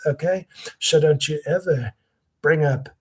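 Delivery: usual speaking voice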